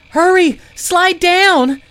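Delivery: loud, high panicked yell; urgent shout. Acted. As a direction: yell at everyone to move now. Stones breaking, urgent escape.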